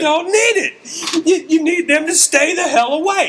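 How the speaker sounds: high-pitched ranting comic impression; Bill Burr. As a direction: rant like an exasperated stand-up comic. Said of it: "That is perfect Bill Burr".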